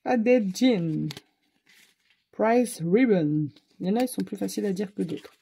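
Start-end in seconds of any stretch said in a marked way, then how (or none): 0.1-3.5 French-accented English names. Real, not acted. Vlogger listing colour names to viewers, French accent. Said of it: "L'accent français".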